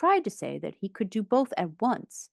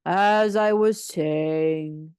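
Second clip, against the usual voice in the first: very slow, booming rebuke; loud and stern; 6 dB louder, much slower than usual. A narrator's reading. Interrupted speaker addressing noisy guests, loud, drawn-out sternness. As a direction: stretch each word out loudly to regain the floor. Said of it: "said Eeyore loudly and sternly".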